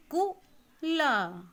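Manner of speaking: पुल drawn out slowly and loud, each sound clear; slow syllabic reading. Shown as a demonstration. Teacher reads पुल slowly, loud and stretched. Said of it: "अक्षर को मात्रा के साथ आप पढ़ेंगे".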